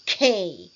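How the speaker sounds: "K" said with a hard, sharp attack. Shown as a demonstration. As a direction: hit the "K" sharply. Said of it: "Sharp K".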